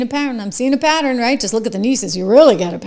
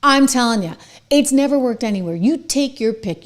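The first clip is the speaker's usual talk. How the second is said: usual speaking voice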